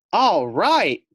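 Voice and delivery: high, loud happy cry; bursting with delight; excited cheer. Acted. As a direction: cheer it out like a proud little pet. Fluffy excited in cab.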